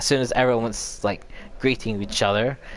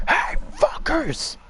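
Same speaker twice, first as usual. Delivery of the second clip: high, slow, strained mock scream; defiant scream; slower than usual. Josh taunting the Wendigos before blowing the lodge, high mock scream. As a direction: hurl it at them, slow and high. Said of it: "Josh screams out saying".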